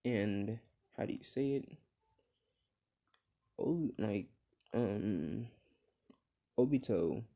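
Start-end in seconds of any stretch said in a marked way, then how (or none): none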